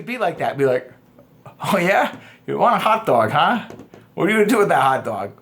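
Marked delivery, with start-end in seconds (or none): 1.6-5.3 playful, smug question; cocky teasing. Shown as a demonstration. Man teasing the girl about her hot dog craving, cocky. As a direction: tease her without being creepy. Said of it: "but you're being cocky".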